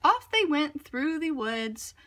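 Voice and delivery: ordinary delivery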